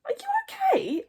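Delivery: very high, squeaky question; high-pitched worried friend; Fishlegs. A narrator's reading. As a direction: ask in a high, concerned squeak. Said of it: "asked Fishlegs".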